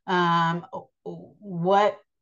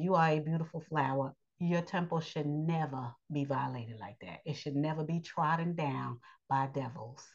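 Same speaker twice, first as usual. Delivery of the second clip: soft, low, solemn appeal; softly lowered voice, earnest counsel; hushed appeal; 6 semitones lower, 9 dB quieter than usual. Real, not acted. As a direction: drop low and soft to plead with women. Addressing women as beautiful flowers.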